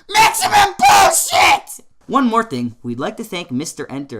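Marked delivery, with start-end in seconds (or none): 0.1-1.8 very high, drawn-out shout; huge hammed-up yell; over-the-top announcement. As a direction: bellow the verdict slowly, far above your normal pitch. Announces rating after clearing throat.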